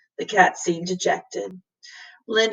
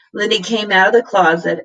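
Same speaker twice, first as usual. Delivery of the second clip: usual speaking voice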